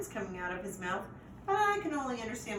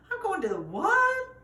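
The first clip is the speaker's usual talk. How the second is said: loud, much higher startled question; shocked; 12 semitones higher, 8 dB louder than usual. A narrator's reading. Puppy learns about the pound, loud high shock. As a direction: blurt it out in shock.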